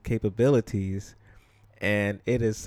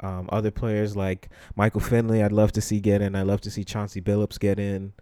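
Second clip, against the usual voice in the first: ordinary talk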